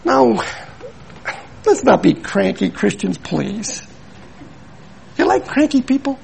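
ordinary delivery

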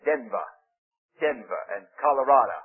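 lightly imitated famous preacher's pronunciation; Billy Graham. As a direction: say Denver in isolation, dropping the final r. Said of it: "he says".

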